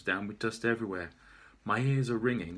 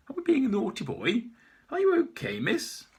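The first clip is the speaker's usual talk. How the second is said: much higher teasing singsong; mocking officer; 12 semitones higher, 6 dB louder than usual. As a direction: tease him like a child. Officer teasing, then asking.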